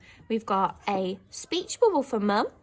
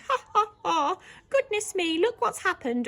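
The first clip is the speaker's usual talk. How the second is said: high, giggly voice; laughing girl; 9 semitones higher than usual. Shown as a demonstration. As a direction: laugh at the funny sight. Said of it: "she might be saying".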